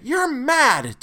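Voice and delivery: high, loud cry of disbelief; sharp cry. A narrator's reading. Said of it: "Todd cried sharply".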